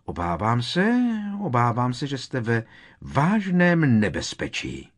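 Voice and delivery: loud, high, portentous warning; dramatic. A narrator's reading. Seer warning the inspector of danger, dramatically. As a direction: draw out the doom theatrically. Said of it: "dodala dramaticky".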